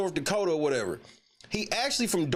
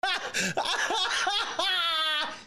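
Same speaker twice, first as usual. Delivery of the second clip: high laughter; 10 semitones higher than usual. Real, not acted. Cracking up at the clip.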